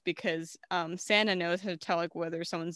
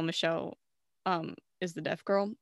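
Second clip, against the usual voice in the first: ordinary talk, nothing marked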